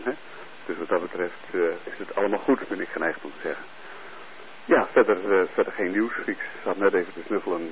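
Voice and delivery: normal talking voice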